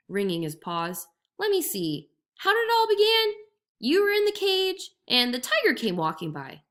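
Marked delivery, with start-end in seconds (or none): 1.4-6.6 higher, louder muddled musing; jackal, feigning confusion. A narrator's reading. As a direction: pretend to puzzle it out. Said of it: "jackal muddles story".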